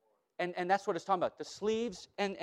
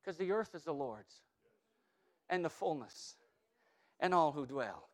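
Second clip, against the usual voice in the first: much softer, lower, calmly unbothered; soft, low, serene quoting of scripture; calm church voice; 4 semitones lower, 6 dB quieter than usual. Pretend. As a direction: soothe like the church amid the horror. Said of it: "here comes the church saying".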